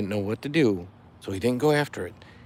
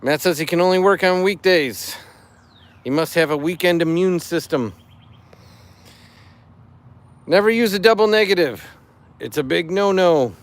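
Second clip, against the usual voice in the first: higher, louder cheesy joke-teller voice; dad joke voice; 5 semitones higher, 4 dB louder than usual. Acted. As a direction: ham up the punchline like a corny dad. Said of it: "I'm doing it in dad joke voice".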